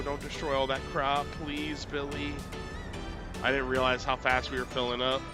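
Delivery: ordinary talk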